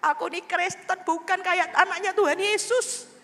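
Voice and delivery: building from a plea to a loud, high cry; retold tearful prayer. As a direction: pray it like you're breaking down, rising to a wail. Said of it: "saya nangis".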